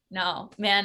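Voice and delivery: ordinary talk, nothing marked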